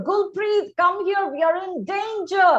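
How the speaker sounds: high-pitched call of alarm; urgent cry. A narrator's reading. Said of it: "She cried to her".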